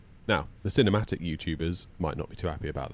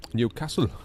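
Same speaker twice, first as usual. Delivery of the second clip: Geordie-style Newcastle accent; 4 semitones higher than usual. Shown as a demonstration. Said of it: "That's my Newcastle accent".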